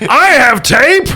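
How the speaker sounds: high, loud, slow dim-witted mutant; drawn-out brute offering tape. Acted. Mutant offers tape to the players, high, loud and slow.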